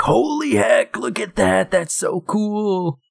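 high, awed, slow imitation of herself; mock-gushing, dragging out so cool; excited gasp. Retelling her reaction to the costume, high and awed. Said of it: "I was like".

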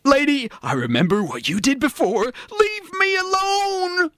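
high-pitched comic alarm, climbing to a drawn-out wail; protest, then stretch the plea way up high; hammed-up frightened victim; panicked protest. Victim fends off the Russian seductress.

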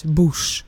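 "bush" ending in a plain s sound, no sh; Dutch pronunciation. Shown as a demonstration. Co-host showing how the Netherlands says the name, "bush" ending in s. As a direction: say "bush" with a final s, like "boos". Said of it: "you pronounce it as bush".

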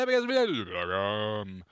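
fast, garbled rattle-off; rushed repeat. Pretend. Host repeating the email address, comically rushed. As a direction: race through it in a put-on voice.

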